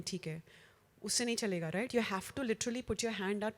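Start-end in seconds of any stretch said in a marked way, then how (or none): 0.0-0.4 a soft, limp, uninterested theek hai; limp fish greeting. Shown as a demonstration. Speaker mocks a weak handshake greeting. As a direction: mumble it like you barely care. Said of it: "fish handshake where you're just".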